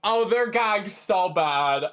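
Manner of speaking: excited. Real, not acted. Excited repeated reaction.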